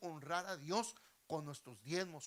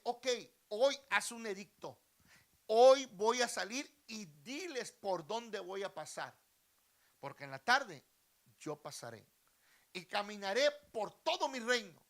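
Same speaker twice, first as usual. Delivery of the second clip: loud royal proclamation; commanding king; 5 semitones higher, 13 dB louder than usual. Acted. King ordering an edict to his attendant, loud and commanding. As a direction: project like a king giving orders.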